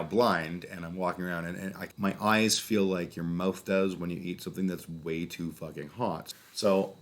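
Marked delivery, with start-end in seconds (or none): none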